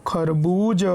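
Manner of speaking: खरबूज run together slowly with ū held; blended, long ū. Shown as a demonstration. Teacher showing how to join letters, खरबूज with a long ū. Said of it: "इस तरीके से मिला करके पढ़ेंगे".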